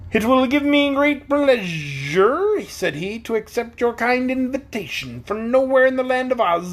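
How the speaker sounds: high, slow, pompous bug; Wogglebug voice. A narrator's reading. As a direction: savour every grand word.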